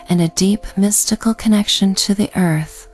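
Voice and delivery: ordinary delivery, nothing marked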